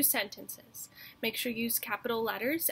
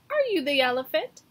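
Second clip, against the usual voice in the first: cocky question; 4 semitones higher, 8 dB louder, faster than usual. Acted. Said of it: "said the mouse".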